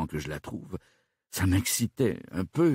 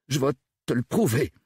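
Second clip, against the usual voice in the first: higher-pitched, urgent comeback; heated retort; 6 semitones higher, 4 dB louder than usual. A narrator's reading. Suspect rising to face detective, retorting heatedly. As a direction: fire back, insisting on proof. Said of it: "riposta Gilbert".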